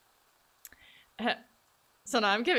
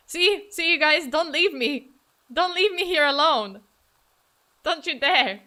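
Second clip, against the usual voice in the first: loud, raised-pitch playful begging; mock pleading; 5 semitones higher, 7 dB louder than usual. Streamer begging viewers not to abandon her, mock pleading. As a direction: call out a melodramatic plea to stay.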